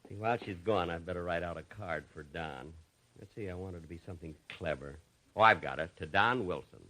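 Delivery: softly musing to himself while the clerk is away; quiet aside. Acted. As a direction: murmur it under your breath. Jack's aside while clerk's gone.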